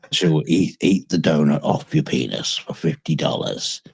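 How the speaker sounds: usual speaking voice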